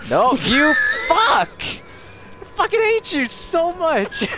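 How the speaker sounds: high laughing rage scream; furious shout. Real, not acted. Friend kills her in game.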